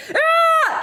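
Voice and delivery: a sudden high, loud scream to scare someone off; a piercing shriek right at the attacker; demo yell. Shown as a demonstration. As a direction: show them how to yell in their face. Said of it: "You know what everyone understands?".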